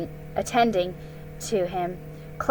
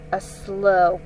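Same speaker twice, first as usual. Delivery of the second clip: ordinary delivery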